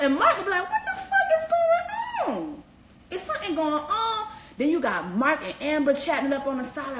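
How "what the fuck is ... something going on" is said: very high, stretched disbelief; incredulous mock shriek. Mark baffled by Jessica, very high stretched disbelief. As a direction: wail it out in shock.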